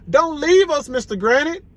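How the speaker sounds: put-on high, loud pleading child; begging. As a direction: beg him not to leave. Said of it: "begged Alexia".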